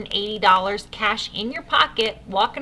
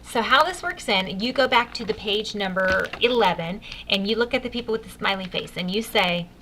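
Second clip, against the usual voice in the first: ordinary talk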